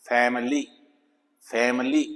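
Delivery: फैमिली with a reduced middle vowel, fam-lee; correct pronunciation. Shown as a demonstration. Teacher gives the right फैमिली, low and soft. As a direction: say फैमिली low and quick, the middle i almost gone. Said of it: "ये भी फैमिली नहीं है".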